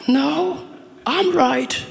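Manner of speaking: high squeaky voices trading no, I'm right; mock bickering. Two friends arguing over a song, high mock squabble. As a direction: play two kids yelling over each other, laughing. Acted quarrel, both sides.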